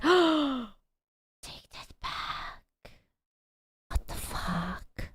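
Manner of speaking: slow, breathy near-whisper; gasp, then whisper it as if deeply offended; mock wounded shock. Streamer told their beard looks bad, whispered mock shock.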